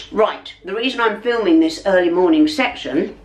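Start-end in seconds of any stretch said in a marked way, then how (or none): none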